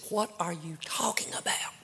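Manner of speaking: hushed whisper. Retold or imitated. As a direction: keep it between you two. Husband hushed at pulpit.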